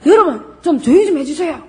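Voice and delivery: teacher fed up with noisy students; exasperated. Shown as a demonstration. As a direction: lose patience with a chatty class. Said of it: "이렇게 나가겠지요".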